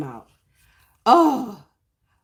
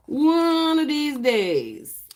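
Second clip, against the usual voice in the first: sing-song drawl; 7 semitones higher, much slower than usual. Real, not acted. Drawn-out teasing repeat.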